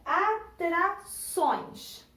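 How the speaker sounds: "atrações" drawn out in separated syllables. Shown as a demonstration. Teacher introducing the first word to pupils, "atrações" broken into syllables. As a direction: say "atrações" slowly, a pause between syllables. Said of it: "vou dividir aqui".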